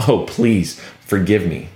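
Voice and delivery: mock apology, dropped low; sarcastic. Paul to those who condemn him, sarcastic. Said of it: "Do you hear the sarcasm?".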